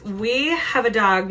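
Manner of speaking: ordinary delivery, nothing marked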